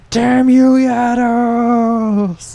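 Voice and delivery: high, drawn-out furious yell; falling man's cry. Acted. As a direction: curse him all the way down. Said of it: "as he's falling".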